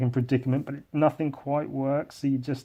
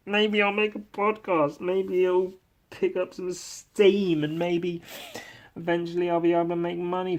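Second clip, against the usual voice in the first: mock dreamy hope; 5 semitones higher than usual. Mocking own hopes.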